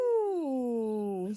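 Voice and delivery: big breathy mock sneeze. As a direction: act out a bear's sneeze. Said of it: "says the bear".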